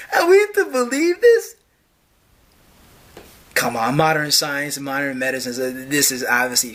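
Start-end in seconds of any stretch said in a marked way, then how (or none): 0.1-1.5 incredulous. Real, not acted. Incredulous mocking question.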